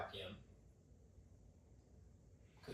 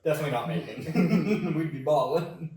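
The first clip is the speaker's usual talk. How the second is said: louder, slow, laughing correction; 4 semitones higher, 9 dB louder, slower than usual. Real, not acted. Laughing emphatic repeat.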